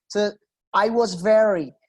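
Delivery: "very" stretched with a smooth American R; American modulation. Shown as a demonstration. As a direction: model the American sound of "I was very". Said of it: "cuando uno hace esa modulación".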